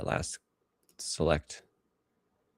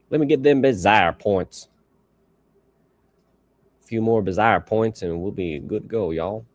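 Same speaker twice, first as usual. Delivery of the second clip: put-on southern accent; 3 semitones higher, 4 dB louder than usual. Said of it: "a more southern accent".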